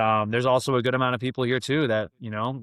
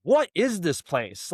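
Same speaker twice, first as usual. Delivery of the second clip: slow disbelief; 7 semitones higher, slower than usual. Retold or imitated. Amazed at the bank's trust.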